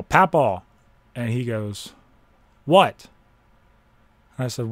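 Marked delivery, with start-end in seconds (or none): 0.0-0.6 loud high call into the night; yelling for grandpa. Retold or imitated. Searching outside in the cold, calling out loud. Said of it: "I yell". 2.7-3.1 loud high call back; shouted reply. Retold or imitated. Grandfather answering from the snow, shouted.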